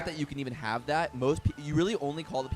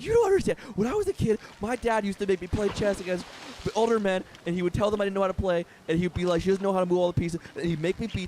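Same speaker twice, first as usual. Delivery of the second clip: imitated meltdown, high-pitched and pressured; freaking out; 7 semitones higher, 4 dB louder than usual. Woman recounting childhood chess games with her dad, imitated freak-out. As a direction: spill it out in a high, mock-frantic voice. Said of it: "She starts freaking out".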